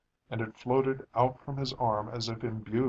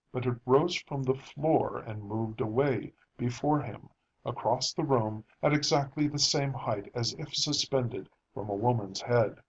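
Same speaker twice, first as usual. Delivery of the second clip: usual speaking voice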